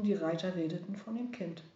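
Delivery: usual speaking voice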